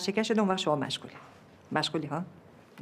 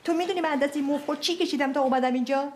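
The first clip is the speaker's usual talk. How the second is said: ordinary talk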